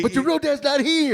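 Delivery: high put-on parent voice; mock stepdad. Stepdad owner answering the sulky dog, high mock lecture. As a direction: lecture the kid, hammed up.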